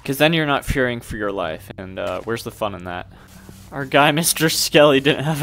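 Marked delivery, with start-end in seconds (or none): none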